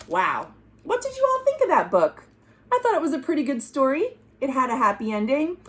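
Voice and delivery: ordinary delivery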